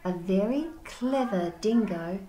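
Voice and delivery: lively storybook read-aloud; reading to children. Acted. Teacher reading Wombat Stew aloud to the class. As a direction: tell it to wide-eyed kids.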